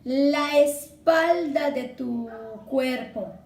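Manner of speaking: "la espalda" slow, with "la" and "espalda" linked into one syllable; sinalefa demo. Shown as a demonstration. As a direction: glide "la espalda" together slowly, showing the sinalefa. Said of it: "Por ejemplo".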